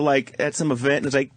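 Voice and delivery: ordinary talk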